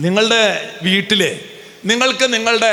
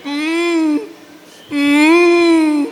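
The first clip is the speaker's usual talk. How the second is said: mock hum; 8 semitones higher, 3 dB louder, much slower than usual. Mock humming before a preached line.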